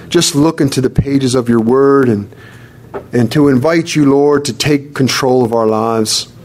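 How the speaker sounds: ordinary delivery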